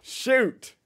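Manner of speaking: high, loud exclamation; taken aback. Real, not acted. Creator hears the accent called obnoxious, startled yelp.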